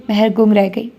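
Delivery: plain reading voice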